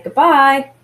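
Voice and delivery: goodbye with the second syllable stronger; goodbye, light good and lifted bye; stress on BYE. Shown as a demonstration. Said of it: "the bye has more emphasis".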